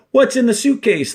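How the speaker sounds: sharp, high demand; warden demanding. Acted. Teacher voicing the warden questioning Stanley. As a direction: bark the question at him. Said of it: "the warden asked Stanley".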